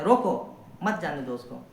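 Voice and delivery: slow, with "रोको" set apart from "मत जाने दो उसको"; pause after रोको. Shown as a demonstration. Teacher quoting a comedy example to students, pause after "रोको". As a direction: stop after "रोको", then run "मत" into "जाने दो". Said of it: "एक आध कॉमेडी में देखे होंगे".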